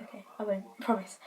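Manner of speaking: soft, whispered reassurance; hushed promise. Retold or imitated. Granddaughter quietly promising her nana, a hushed murmur. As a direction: murmur it so nobody hears.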